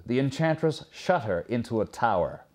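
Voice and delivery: plain reading voice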